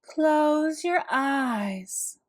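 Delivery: slow, hushed calming voice; a soothing therapist. A narrator's reading. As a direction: coax the patient into calm. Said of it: "she said".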